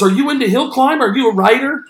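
high, eager questioning; mock amazement, pitched well up; imitating freaking out. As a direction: mimic a flabbergasted caller firing questions. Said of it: "Now he's really freaking out".

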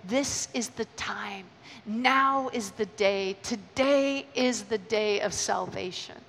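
soft, slow, weighty; quiet slow proclamation; slow solemn call. Real, not acted. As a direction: let each word land.

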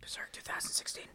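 hushed whisper. Real, not acted. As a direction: whisper it like a curse. Whispering the dreaded title.

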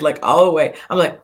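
ordinary delivery

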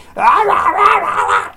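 high gibbering yell; mock kid screaming. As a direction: imitate kids going wild. Imitates kids screaming when threatened.

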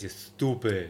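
loud, mock New York Italian insult; joking Italian-American accent; put-on accent. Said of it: "who started that accent".

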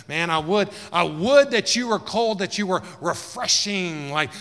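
high and loud, pleading; loud pleading rebuke. Acted. Jesus wishing the church were cold, high loud plea. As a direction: cry it out with longing. Preacher voicing Jesus' longing.

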